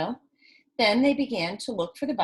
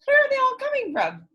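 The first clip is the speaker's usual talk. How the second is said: amazed question; 5 dB louder, faster than usual. Real, not acted. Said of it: "Can you believe all these buttons".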